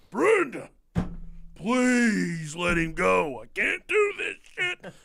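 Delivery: high, slow, fed-up plea to his wife; exasperated dad. Retold or imitated. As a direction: beg like a dad at his wits' end.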